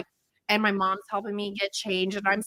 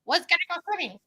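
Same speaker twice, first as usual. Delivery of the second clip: put-on child voice; 6 semitones higher than usual. Her child self voiced.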